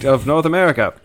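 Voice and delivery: brash huckster patter; fast-talking salesman. Acted. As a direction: sell it like a fifties phone company rep. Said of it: "The first telemarketer".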